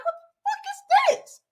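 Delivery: high-pitched shocked cry at her own reflection; shocked squeal. Retold or imitated. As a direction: squeal it like a startled kid at the mirror. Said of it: "And I'm like".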